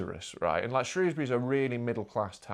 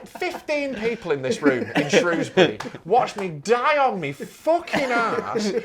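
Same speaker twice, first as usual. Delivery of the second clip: usual speaking voice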